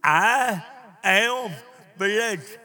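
"I am blessed" with a strong "I am", then a pause before "blessed"; leading a recitation. Shown as a demonstration. Preacher leads congregation in saying the story, "I am blessed" measured out. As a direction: lead the crowd through "I am blessed" in measured pieces. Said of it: "let's say our story together".

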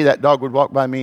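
normal talking voice

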